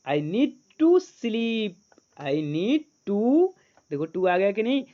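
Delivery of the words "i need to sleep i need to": words stretched very slowly; very slow read-aloud. Shown as a demonstration. Teacher reading the sentence slowly for students to repeat. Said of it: "जल्दी से जल्दी set करो".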